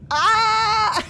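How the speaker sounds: high yelp then laughter; yelp, then crack up; startled shriek into laughter. Real, not acted.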